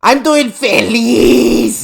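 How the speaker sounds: slow, loud, high mock Mexican accent; hammed-up Mexican accent, long stretched last syllable; exaggerated Spanish. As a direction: imitate a cartoon iguana overdoing the Spanish. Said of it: "she fucking exaggerates it".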